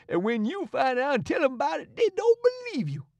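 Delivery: high, pleading, frightened servant voice; wild and distressed. A narrator's reading. Frightened servant begging the boys not to tell. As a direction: beg them, scared of witches. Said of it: "looking wild and distressed".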